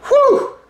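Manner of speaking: sharp high relieved whew. Real, not acted. Relief after spicy challenge.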